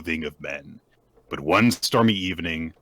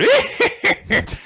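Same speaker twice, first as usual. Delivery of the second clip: put-on high cackling old man laugh; imitated cackle; 7 dB louder than usual. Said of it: "in the corner starts going".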